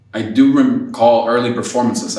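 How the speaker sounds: ordinary delivery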